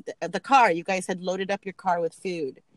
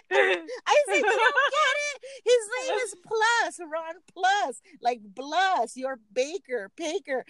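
higher, laughing through the words; laughing explanation; 10 semitones higher than usual. Real, not acted. Telling boyfriend the joke, laughing hard. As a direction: explain it while cracking up.

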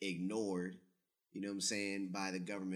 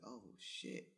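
breathy whispered shock; hushed oh shit; 3 semitones higher, 9 dB quieter than usual. Retold or imitated. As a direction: let it slip out under the breath, stunned. Reacting to being called colored.

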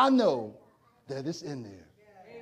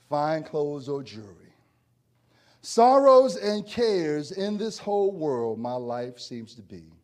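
reciting hymn lyrics; 3 semitones lower, slower than usual. Acted. Recites song lyrics slowly.

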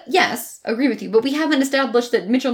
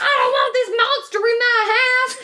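mock Southern accent; 10 semitones higher than usual. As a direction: parody a Southern movie mom's accent, pitched up. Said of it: "cat on a hot tin roof Southern accents".

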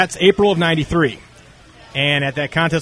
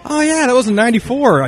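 remembering; 9 semitones higher, 4 dB louder than usual. Retold or imitated. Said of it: "And I was like".

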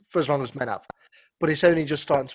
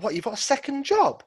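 incredulous; 10 semitones higher than usual. Pretend. Imagined future incredulous reaction.

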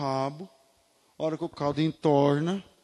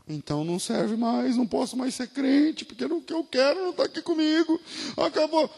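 mock whine, higher and rising to much higher; whiny complaint; 10 semitones higher than usual. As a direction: imitate the disappointed believer, voice climbing high. Imitando o crente frustrado. (imitando o crente frustrado, voz subindo bem aguda)

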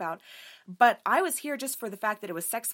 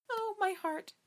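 swooning gasp; 10 semitones higher, 5 dB quieter, slower than usual. Real, not acted. Swooning over a book quote.

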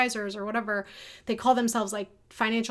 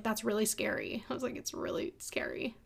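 hushed and emotional; 8 dB quieter than usual. Retold or imitated. Daughter recalling crying with her mom over debt, hushed and emotional. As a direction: let it drop away almost to nothing.

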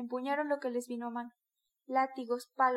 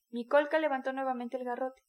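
ordinary delivery, nothing marked

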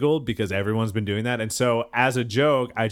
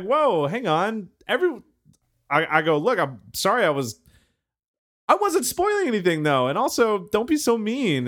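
defensive protest; 10 semitones higher than usual. Retold or imitated. He defends himself to the angry girl, high mock protest. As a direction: throw your hands up and plead your case, higher. Retells his own defensive reply.

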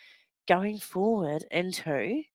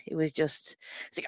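ordinary talk

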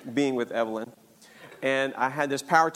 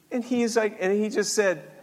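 usual speaking voice

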